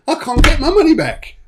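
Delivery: very high, plaintive whine; mock defeated. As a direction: lament it like a thwarted debt collector. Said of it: "felt so defeated 'cause he'd lost".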